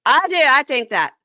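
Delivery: high, loud, defiant retort, imitated; retelling the fiancée snapping back during a fight, mock loud; mock shouted retort. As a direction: throw it back at him, imitating her loudly. Heated quarrel, retold fiancée's retort.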